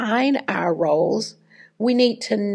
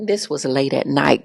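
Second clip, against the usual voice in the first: normal talking voice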